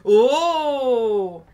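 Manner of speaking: loud, long-drawn "oooh" of wonder; admiring oh. Real, not acted. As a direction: let out a big, drawn-out awed oh. Admiring the machine as it opens. (grand « oh » admiratif)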